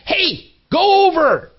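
high, slow shout to shoo. Retold or imitated. Father outside shooing the bird, high slow shout. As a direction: call out sharply to scare it off. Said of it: "tries to shoo the bird".

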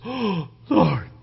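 breath caught in mock terror; acted awestruck gasp. Balaam sees the angel with a sword, awestruck gasp. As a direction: gasp it out, startled.

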